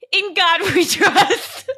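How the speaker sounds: slow, giggling sign-off; laughing catchphrase. Real, not acted. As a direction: drag it out through laughter.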